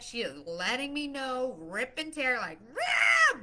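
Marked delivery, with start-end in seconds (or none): 2.7-3.4 a high, loud mock baby shriek of "Rah!"; imitated baby scream. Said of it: "blood curdling scream".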